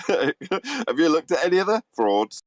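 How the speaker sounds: slow, high, laughing re-quote; laughing retelling. Retold or imitated. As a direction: repeat the joke through laughter. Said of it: "comic aside".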